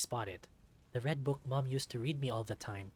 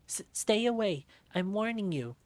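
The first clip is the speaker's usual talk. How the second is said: scared warning; 8 semitones higher, 6 dB louder than usual. Acted. Scared kid threatening.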